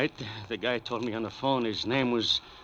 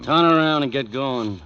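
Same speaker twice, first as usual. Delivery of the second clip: loud order; 4 semitones higher, 9 dB louder than usual. Acted. Gunman orders at gunpoint.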